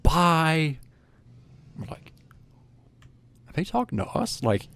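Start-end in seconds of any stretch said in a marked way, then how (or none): 0.0-0.8 drawn-out, higher-pitched mock yell; imitated yell. Imitating unseen people calling from another room, mock yell. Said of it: "we start hearing them yell". 3.5-4.4 high, softer bewildered question; retold confusion. Boys wondering about the yelling, confused.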